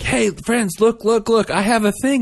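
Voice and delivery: put-on high, eager child showing off his treasure; squeaky kid voice calling his friends over; excited little kid. Mother imitating her son bragging to classmates, high and excited.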